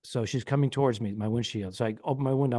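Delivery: ordinary talk, nothing marked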